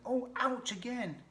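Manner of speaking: high, slow, loud moan of pain; hurt pack rat. Acted. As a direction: complain in a high critter voice, pricked again.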